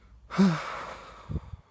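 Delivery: loud, breathy, falling sigh; heavy sigh. Real, not acted. Talking about withdrawal, a loud weary sigh. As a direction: let out a big weary sigh.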